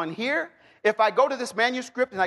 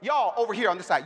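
ordinary delivery

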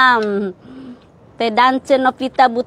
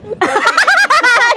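high laugh; 12 semitones higher than usual. Real, not acted. Caught not noticing something.

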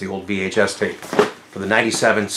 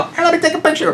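very high, put-on picture request; mock eagerness; faster than usual. Lady openly snapping the car, mock eagerness. Mimics someone taking photos openly.

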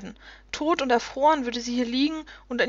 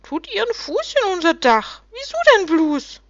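high, loud grumbling gnome voice; grumpy little creatures; 9 semitones higher, 5 dB louder than usual. A narrator's reading. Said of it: "seufzten ebenso griesgrämig wie vorher".

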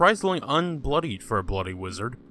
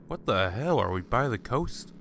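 usual speaking voice

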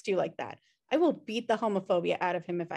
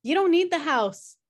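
louder, higher, snapping back; heated; 7 semitones higher, 7 dB louder than usual. Acted. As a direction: push back hard on don't. Siblings fighting over the will.

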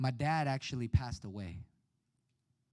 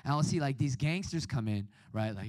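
usual speaking voice

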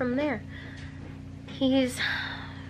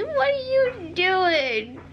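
playful scolding; 7 semitones higher, much slower than usual. Real, not acted. Mom to toddler poking the camera lens, slow playful question. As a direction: gently ask what the little one is up to, drawn out. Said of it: "don't poke the lens".

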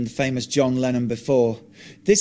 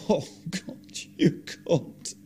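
whispered through laughter, much softer; can barely get it out for laughing; breathless laughing whisper; 3 semitones higher, 5 dB quieter, slower than usual. Acted. Martin teasing John about Ono, whispering through laughter.

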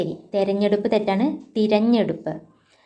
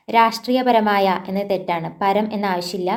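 ordinary delivery

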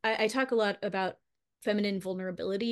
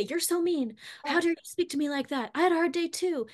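higher-pitched mock outrage; indignant accusation; 7 semitones higher than usual. Wife acting what she didn't say to husband, indignant. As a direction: act out snapping back, offended and high.

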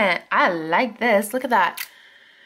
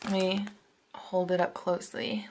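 ordinary talk, nothing marked

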